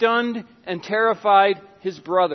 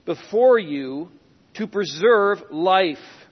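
slow emphasis; 3 dB louder, slower than usual. Acted. Slow emphatic scripture reading.

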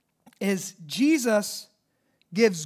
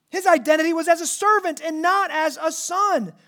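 higher, louder pulpit build; rising sermon fervor about sonship; preaching climax; 8 semitones higher, 6 dB louder than usual. Acted. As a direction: press the point from the pulpit. Preaching at full force.

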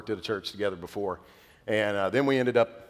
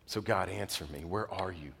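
softer, pleading question to God; hushed plea; 2 semitones lower, 7 dB quieter than usual. Retold or imitated. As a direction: ask it softly, almost lost. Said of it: "I'm crying out".

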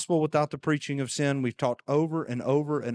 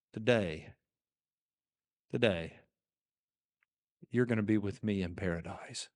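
hushed promise; 6 semitones lower, 6 dB quieter than usual. Acted. Jesus' promise, hushed and slow.

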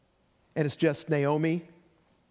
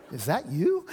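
flat, unimpressed question; disdainful doubt. Shown as a demonstration. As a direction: sound let down by how they look. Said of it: "Not the".

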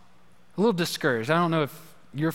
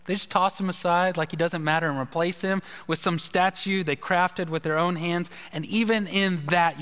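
ordinary talk

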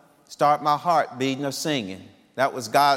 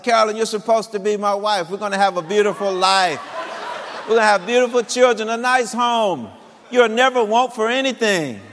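higher, impassioned pleading; pouring his heart out; 6 semitones higher than usual. Retold or imitated. Suitor pleading with his old girlfriend, impassioned. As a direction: sell her the whole dream in one breath. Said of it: "I just poured my heart out".

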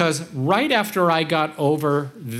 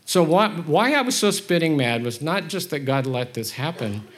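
ordinary talk

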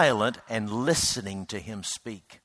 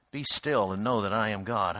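soft repetition; 5 semitones lower, 6 dB quieter than usual. Acted. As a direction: lower and softer "Be still and know that I am God". Repeating the psalm softly.

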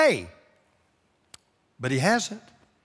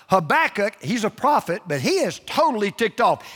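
ordinary talk

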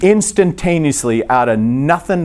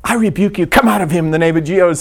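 mock exorcist; 4 semitones higher, faster than usual. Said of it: "you wouldn't go".

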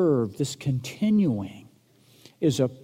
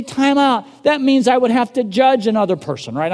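a loud, higher mock protest; mock objector; 7 semitones higher, 8 dB louder than usual. Preacher voicing a listener who refuses to judge, loud mock protest. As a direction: throw up the listener's objection, half-hearted.

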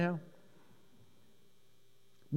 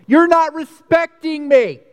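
high, loud, slow hurt complaint; offended complaint; 14 semitones higher, 12 dB louder, slower than usual. Pretend. Someone mad at another person, high loud hurt complaint. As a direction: whine it like someone feeling disrespected.

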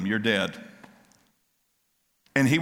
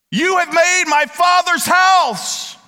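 much higher and louder, slow, thundering rebuke; furious shout; 10 dB louder, slower than usual. Acted. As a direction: roar it at the money changers, slowly. Jesus clearing the temple.

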